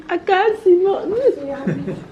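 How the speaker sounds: slow, high words through giggles; laughing. Real, not acted. Wife unable to see inside the castle, laughing. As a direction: giggle helplessly as you say it.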